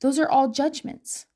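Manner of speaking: ordinary talk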